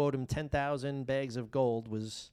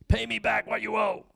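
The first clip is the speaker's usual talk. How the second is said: higher, louder harsh demand; angry demand; 8 semitones higher, 7 dB louder than usual. Acted. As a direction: bark it at the debtor. Servant choking his fellow servant.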